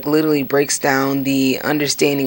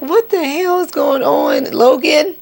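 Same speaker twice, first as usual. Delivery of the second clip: mock disbelief; 13 semitones higher, 4 dB louder than usual. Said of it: "I'm like".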